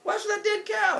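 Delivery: high, hammed-up squabble; comic high-pitched outrage; mock yelling. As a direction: shoot it back way up high. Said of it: "they start yelling back and forth".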